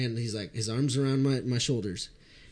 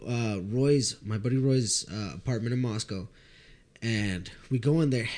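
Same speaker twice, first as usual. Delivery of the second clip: ordinary delivery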